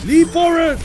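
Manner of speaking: high, loud shout; battle cry. Real, not acted. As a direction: yell the attack as you pounce. Shouting the game move.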